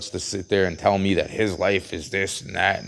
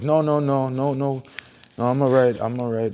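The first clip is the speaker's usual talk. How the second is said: higher, brushing-off no no no; imitating the injured guy; 5 semitones higher than usual. The hurt man refusing police help, lightly imitated brush-off. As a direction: wave off the help, lightly voiced. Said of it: "He's like".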